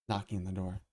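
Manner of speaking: usual speaking voice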